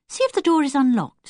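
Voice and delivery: put-on a young girl marvelling at a cottage; Anne's voice. Girl admiring the little cottage, delighted.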